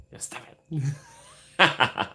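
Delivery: slow laughing "You stop it"; drawn out through a laugh; bashful laugh at the praise; laughing, stretched out. Real, not acted. Flattered by likes, laughing.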